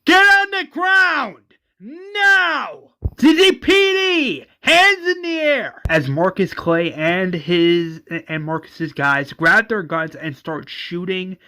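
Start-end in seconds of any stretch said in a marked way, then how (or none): 0.0-5.8 high, loud police command scream; screaming at suspects. Retold or imitated. Joe storms the warehouse and screams at the crew, full-voiced yell. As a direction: bellow the order like a cop storming in. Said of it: "as Joe screams".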